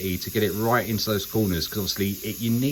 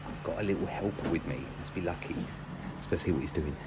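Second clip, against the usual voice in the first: hushed; 4 semitones lower, 10 dB quieter than usual. Real, not acted. Helper nearby, speaker hushes.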